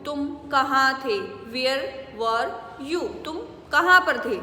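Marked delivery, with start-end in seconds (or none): none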